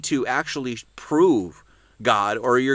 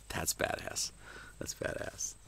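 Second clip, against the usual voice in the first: awed whisper; 16 dB quieter than usual. Real, not acted. Awed reaction after reading poem.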